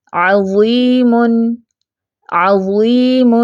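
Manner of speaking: عظیم very slowly, thick ظ; heavy zaa. Shown as a demonstration. Teacher shows the correct heavy ظ. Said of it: "وا کو fat رکھنا ہے".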